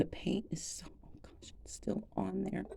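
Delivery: hushed whisper of amazement; awed whisper. Real, not acted. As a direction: breathe it out in wonder. Marvelling at intact paint.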